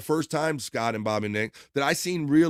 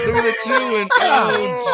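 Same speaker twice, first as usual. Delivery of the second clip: laughing shout; 8 dB louder than usual. Real, not acted. Host shouting at clip.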